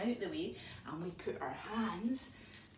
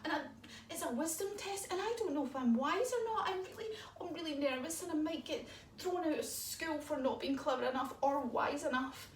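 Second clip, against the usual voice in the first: a hint of a worried child owl, higher; lightly voiced nervous little owl; 6 semitones higher than usual. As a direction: fret like a small anxious owlet. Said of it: "And she says".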